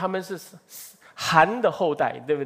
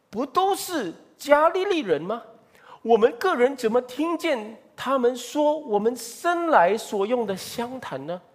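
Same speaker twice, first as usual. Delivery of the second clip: high, astonished questioning; amazed crowd; 7 semitones higher than usual. Acted. Preacher voicing the amazed crowd, astonished and high. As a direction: read the crowd's astonishment aloud, pitched up. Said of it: "那就看哪".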